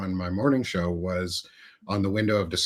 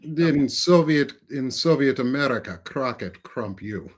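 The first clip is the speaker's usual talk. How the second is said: mock Yakov Smirnoff Russian accent; 2 semitones higher than usual. Russian joke impression.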